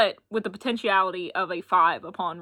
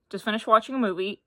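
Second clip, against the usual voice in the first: normal talking voice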